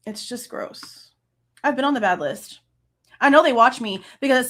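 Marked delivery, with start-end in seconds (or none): 0.1-1.1 disgusted whisper. Real, not acted. Disgusted aside after angry rant.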